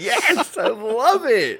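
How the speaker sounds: very high, drawn-out gleeful squeal; delighted squeal. Retold or imitated. Replaying a reaction to the movie joke, squealed delight. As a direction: squeal it slowly in exaggerated delight.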